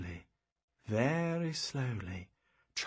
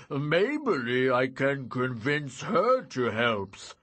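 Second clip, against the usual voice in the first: loud, slow lumbering giant; slow simple-minded giant, glum; 6 dB louder, much slower than usual. Acted. Giant Shim speaking.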